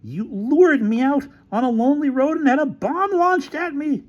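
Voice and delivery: high-pitched tirade; outraged accusation. Acted. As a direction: pour out your grievance indignantly. Quarrel, Featherstone's outraged accusation.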